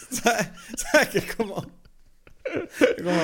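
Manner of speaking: cracking up while asking; laughing so hard the voice shoots up; real laughter. Real, not acted.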